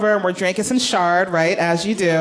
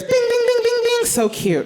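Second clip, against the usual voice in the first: high sing-song bing bing; playful high footstep sounds; 1 cute stair steps. Retold or imitated. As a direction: chirp the steps up high like a little kid.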